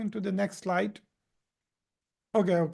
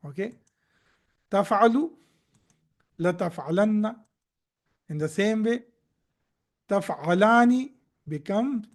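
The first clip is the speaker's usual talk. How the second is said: ordinary talk, nothing marked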